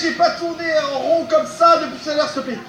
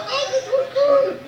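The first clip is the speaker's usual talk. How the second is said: 1 delighted laugh; 11 semitones higher, slower than usual. Real, not acted. Thrilled at new base.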